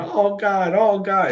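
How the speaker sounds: high, loud mock moan.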